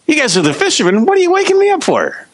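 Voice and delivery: very high, squeaky-pitched mock disbelief; incredulous. Jesus to the fishermen who woke him, incredulous. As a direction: complain in exasperated falsetto at being woken.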